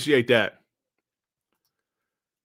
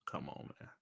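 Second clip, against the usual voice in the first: whispered, much softer sigh of disbelief; exasperated whisper; 25 dB quieter than usual. Real, not acted. Streamer sighing at rival channels in a soft whisper. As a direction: breathe it out in disbelief.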